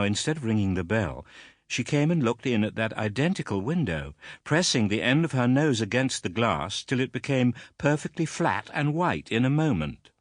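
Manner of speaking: ordinary delivery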